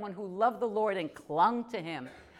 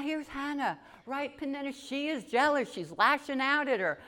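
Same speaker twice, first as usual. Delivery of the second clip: ordinary talk, nothing marked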